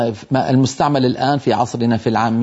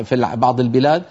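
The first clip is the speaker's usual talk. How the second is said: ordinary talk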